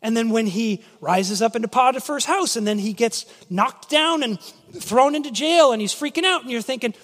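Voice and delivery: ordinary talk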